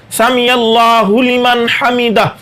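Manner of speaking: slow समी अल्लाहु लिमन हमीदा, each word full; teacher repeats the right form slowly; correct pronunciation. Shown as a demonstration. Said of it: "ये अल्फाज दुरुस्त है".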